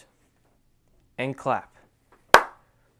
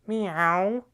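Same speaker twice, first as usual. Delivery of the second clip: a higher, catlike Meow; cat meow; 5 semitones higher than usual. Shown as a demonstration. Dance teacher imitating a cat for his students. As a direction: mew it like a kitten. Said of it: "Then cat goes meow".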